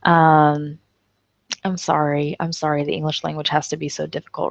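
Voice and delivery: ordinary talk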